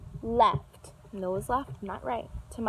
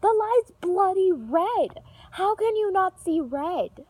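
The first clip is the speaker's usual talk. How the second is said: high, louder outraged demand; indignant yell; 9 semitones higher, 5 dB louder, slower than usual. Retold or imitated. Yelling at the driver who ran the red. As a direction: hurl the question at him, slowly. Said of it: "I'm like".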